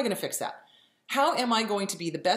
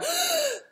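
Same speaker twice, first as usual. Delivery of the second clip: high startled gasp; sharp gasp; 3 dB louder than usual. Retold or imitated. Body reacting to stress hormone, a sharp high gasp. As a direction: gasp as if suddenly scared. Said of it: "and we go".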